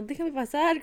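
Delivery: ordinary talk, nothing marked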